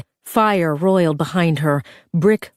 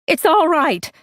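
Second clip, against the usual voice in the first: screaming back; 11 semitones higher than usual. Acted. Claire screaming reassurance back to her sister, high shout. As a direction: shout comfort over the fire. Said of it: "Claire screamed back".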